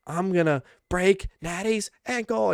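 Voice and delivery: mock screaming. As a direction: imitate a wrestler's screaming threat. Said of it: "she's, like, screaming".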